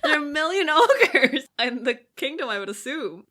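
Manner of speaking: laughing retort. Retold or imitated. Host answering the prince in her head, laughing retort. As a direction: scoff while cracking up.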